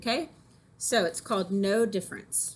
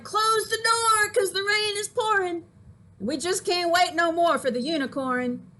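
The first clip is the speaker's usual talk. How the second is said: Noah crying out; 9 semitones higher, 4 dB louder than usual. A narrator's reading. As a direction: shout it out as Noah closing the ark. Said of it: "Noah cried".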